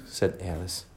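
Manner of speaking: plain reading voice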